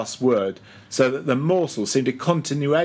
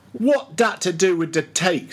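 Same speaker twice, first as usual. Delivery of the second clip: a touch of testy impatience, pitched up; 6 semitones higher than usual. Acted. Old cook answering Stubb's questions, lightly testy. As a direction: snap it back, mildly irritated.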